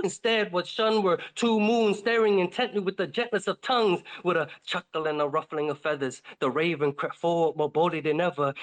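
plain reading voice

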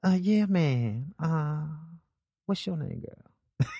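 slow, drawn-out pimp come-on; smooth pimp. Retold or imitated. Pimp chatting up women he baited, smooth pimp voice. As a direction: imitate a pimp easing into a pickup. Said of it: "we had a pimp".

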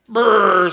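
shivering brrr. A narrator's reading. Soaked by seawater.

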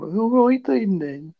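put-on Brummie with a high lilt; exaggerated singsong Brummie; Birmingham accent. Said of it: "I can do a Birmingham".

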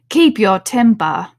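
loud, slow stern warning. A narrator's reading. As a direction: warn her coolly. Caterpillar scolds Alice.

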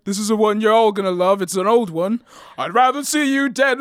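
lightly voiced rock star hyping the crowd; mock stage announcer, a bit high; Paul. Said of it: "Imagine Paul being like".

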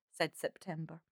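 ordinary delivery

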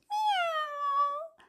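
high, soft kitten meow. Pretend. Imitating the cat for babies, high meow. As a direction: mew like a tiny cat. Cat sound in baby rhyme.

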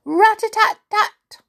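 high, loud rat-a-tat; bright storybook door-knock noise; spoken knocking. A narrator's reading. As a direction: imitate a knock with sharp high syllables. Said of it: "and she knocked".

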